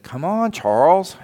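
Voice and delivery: high, loud, rising coax; coaxing call. Retold or imitated. Boy on playground calling Charles back, high coaxing call. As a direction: call him along like a big brother.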